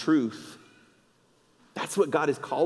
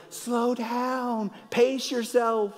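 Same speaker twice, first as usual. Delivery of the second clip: high, slow call from the sidelines; parent calling out; 12 semitones higher, much slower than usual. Retold or imitated. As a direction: shout advice to a running kid, slow it down. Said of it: "And you're like".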